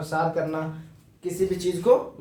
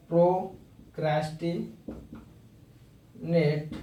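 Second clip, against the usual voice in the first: slow drawn-out word; much slower than usual. Shown as a demonstration. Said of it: "खुद से बनाने का कोशिश कीजिए".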